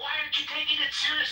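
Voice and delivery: high, loud and fast accusing; mock indignant outburst. Frightened locals accusing organizer of not caring, high loud outrage. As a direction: throw the accusation back, exasperated. Panicked people confronting the organizer.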